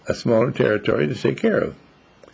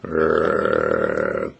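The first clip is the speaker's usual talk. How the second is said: very low rumbling growl; dog growl. Retold or imitated. Sick old dog warns off Rex, low growl. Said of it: "the other dog looks at Rex and said".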